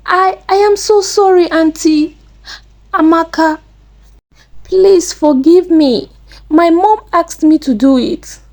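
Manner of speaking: high-pitched, distressed apology; tearful pleading. A narrator's reading. Caught Chisom begs Auntie Amaka's forgiveness, pleading on the edge of tears. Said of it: "Chisom said and started crying".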